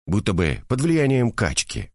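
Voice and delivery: plain reading voice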